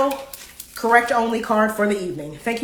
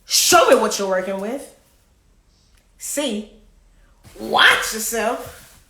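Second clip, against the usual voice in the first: doing [name]; slower than usual. Retold or imitated. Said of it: "That was my best mystical".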